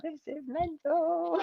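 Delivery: high, slow, stunned exclamation; awed voice at the big walkout; amazed. Retold or imitated. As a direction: gasp it out in disbelief. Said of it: "I was like".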